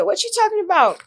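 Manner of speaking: high-pitched comeback, acting out the argument; incredulous retort. Telling how she answered the joke-stealing charge, incredulous retort. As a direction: throw it back in high-pitched disbelief. Acts out her retort to accuser.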